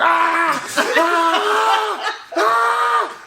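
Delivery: high yelling screams for the neighbors; mock screaming. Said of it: "I'm like".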